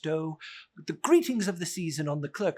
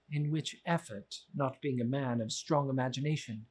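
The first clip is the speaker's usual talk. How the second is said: normal talking voice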